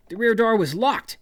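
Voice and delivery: high, loud exasperated cry; exclaimed. Retold or imitated. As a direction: blurt it out like a sudden discovery.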